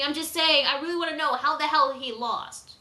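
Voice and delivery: ordinary talk, nothing marked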